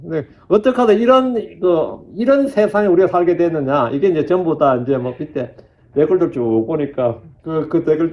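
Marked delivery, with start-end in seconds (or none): none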